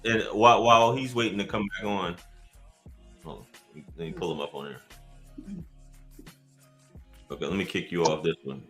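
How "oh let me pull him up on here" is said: normal talking voice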